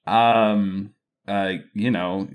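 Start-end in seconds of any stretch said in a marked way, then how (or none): none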